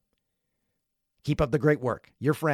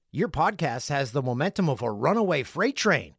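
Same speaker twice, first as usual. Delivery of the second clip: ordinary delivery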